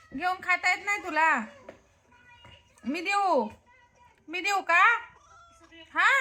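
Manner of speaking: high, sing-song coaxing voice; high pitched pet talk, gently pleading. Real, not acted. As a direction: coax a sulking little pet to eat. Coaxing a sulking pet to eat.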